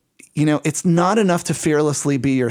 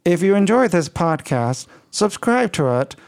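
slightly flat, stiff speech-synthesizer voice in a mild French tint; Stephen Hawking voice. Pretend. Host keeps the Hawking-in-French voice for the outro. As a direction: play a computer voice reading the outro, lightly. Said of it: "like Stephen Hawking speaking French".

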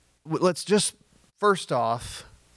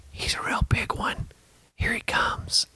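hushed hunter's whisper; hunting show whisper. Retold or imitated. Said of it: "they keep whispering".

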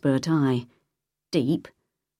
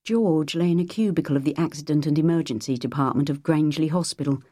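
ordinary delivery

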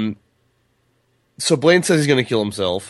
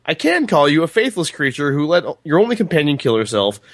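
raised, declaimed storybook insults; higher-pitched formal tirade; reading a character's tirade; 4 semitones higher than usual. A narrator's reading. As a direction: read it out theatrically, relishing each insult. Reads Roland's insult speech from book.

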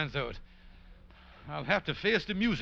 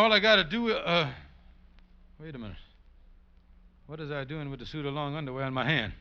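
ordinary delivery

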